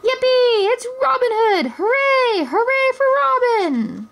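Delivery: put-on very high, shrill child cheers; cry of joy. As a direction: cheer your hero. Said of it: "a cry for joy".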